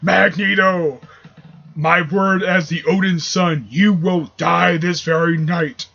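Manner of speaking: slow, booming declamation of an angry Norse god; mad Thor. Acted. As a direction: proclaim the oath grandly, word by slow word. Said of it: "he is mad, really mad".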